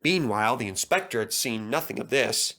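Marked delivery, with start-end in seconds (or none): none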